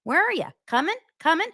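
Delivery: high, singsong mocking friend calls; sarcastic bar-friend impression; mock party chatter. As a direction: ham up the cheery come over calls.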